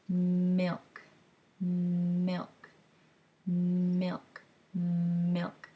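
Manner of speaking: put-on slow, clear "milk" repeated for a baby; modelled word for baby. Shown as a demonstration. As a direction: say "milk" clearly and slowly, lips pressed on the m. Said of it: "You're saying it and you're signing it".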